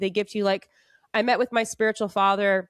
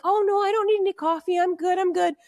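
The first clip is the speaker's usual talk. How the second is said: high, timid voice, politely declining; shy polite refusal; 10 semitones higher than usual. Retold or imitated. Shy guest turning down a monk's coffee offer, high and timid. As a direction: sound too shy to accept anything. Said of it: "I'm so shy".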